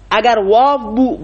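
usual speaking voice

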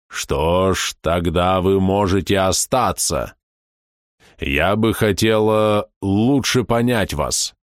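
very slow, eerie creature; a vast being speaking slowly. Acted.

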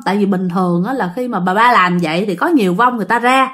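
plain reading voice

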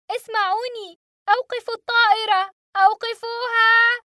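high, loud, final word slowed; frantic shouting. A narrator's reading. Boy pounding the cockpit door, shouting. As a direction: beg them to stop the plane.

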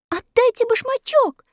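put-on high girl's cry, demanding; girl shouting. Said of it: "закричала Элли".

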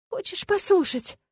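high, slow, coaxing question; coy invitation. A narrator's reading. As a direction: offer it slowly and teasingly. Coaxing him to hear her heart.

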